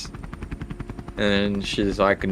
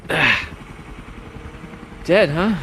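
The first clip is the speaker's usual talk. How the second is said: higher character voice; lightly voiced woman, pitched up on a question; character's reaction; 7 semitones higher, slower than usual. Woman hearing that Jeff is dead, lightly in character. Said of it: "She's like".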